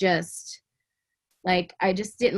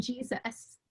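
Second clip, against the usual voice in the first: ordinary talk, nothing marked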